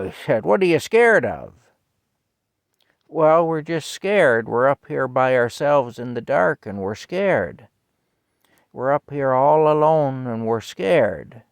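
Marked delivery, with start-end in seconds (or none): 0.4-1.5 loud, high incredulous holler; old man yelling up. Retold or imitated. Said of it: "the old man yelled up". 3.1-11.4 loud, higher retold child's wail; kid yelling down. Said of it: "one of the kids yelled down".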